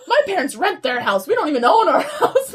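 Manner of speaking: loud, high ranting through laughter; mock-mad tangent. Teen telling crush about parents' rented house, mock-mad. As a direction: retell a defensive rant, cracking up. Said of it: "I went on this whole tangent".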